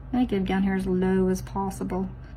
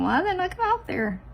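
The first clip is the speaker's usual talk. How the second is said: mock puzzled self; 11 semitones higher than usual. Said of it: "Me just thinking".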